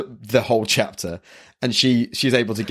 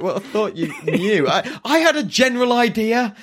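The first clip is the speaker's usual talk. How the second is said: Luffy excuse; 10 semitones higher than usual. Retold or imitated. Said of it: "it's like".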